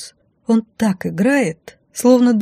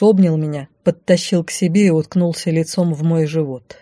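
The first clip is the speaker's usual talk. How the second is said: usual speaking voice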